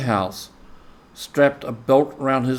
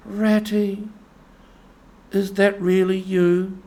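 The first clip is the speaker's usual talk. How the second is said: much higher, small frightened Mole voice; a weak little voice from inside a hole; timid Mole calling from the hollow; feeble voice; 8 semitones higher than usual. A narrator's reading. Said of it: "came a feeble voice saying".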